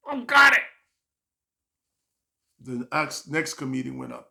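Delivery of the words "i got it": loud high forced bravado; tough guy. Retold or imitated. Nervous comic faking courage, loud bravado. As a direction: puff up and shout it. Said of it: "tough it up like a man".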